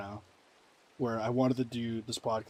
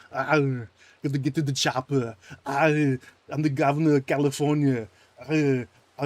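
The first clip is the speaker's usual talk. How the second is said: put-on loud Austrian action-hero accent; Schwarzenegger impression; 3 semitones higher, 9 dB louder than usual. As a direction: bark it like the Terminator, Austrian accent. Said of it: "Schwarzenegger plays Minecraft".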